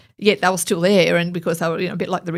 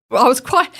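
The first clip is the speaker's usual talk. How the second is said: ordinary delivery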